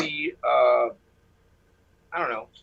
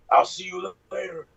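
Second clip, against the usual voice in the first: taunting entity voice; 3 semitones higher than usual. Retold or imitated. Caller mimics the laughing thing's taunt, eerie voice. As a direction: imitate a menacing spook saying goodbye. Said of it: "that thing starts laughing".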